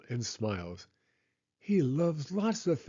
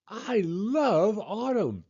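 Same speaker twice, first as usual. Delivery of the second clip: put-on child's delight; 8 semitones higher, slower than usual. A narrator's reading. Happy Pete, proud of souvenirs.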